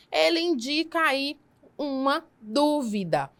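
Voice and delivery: ordinary delivery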